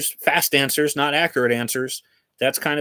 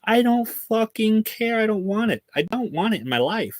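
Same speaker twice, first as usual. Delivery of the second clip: exasperated outburst; 10 semitones higher than usual. Real, not acted. Ranting about AI apologies, exasperated and higher. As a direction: blurt it out, totally done with it.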